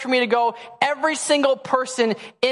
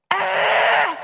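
put-on a one-year-old demanding more, pitched way up; demanding baby; 10 semitones higher than usual. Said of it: "she looks at me and goes".

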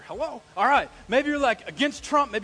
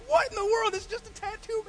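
high-pitched mock incredulity; exasperated disbelief; 13 semitones higher, 4 dB louder than usual. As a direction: protest high, as if it's absurd. Retold disbelief at offended people.

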